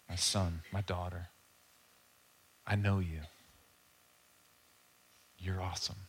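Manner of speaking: low, soft, unhurried fatherly tenderness; tender Jesus voice. Acted. Jesus looking a believer in the eyes, tender. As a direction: speak gently and low, with pauses to let it land.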